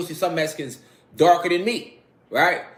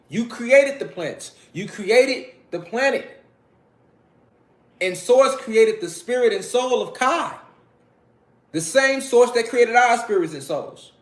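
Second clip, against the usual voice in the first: rising fervor; 8 semitones higher than usual. Real, not acted. Preaching about our divine origins.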